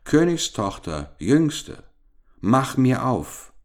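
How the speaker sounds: high, lilting storybook call; calling at the door. A narrator's reading. Frog knocks and summons the princess, calling out. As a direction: sing out the summons, slowing at the end. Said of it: "klopfte es zum zweiten Mal und rief".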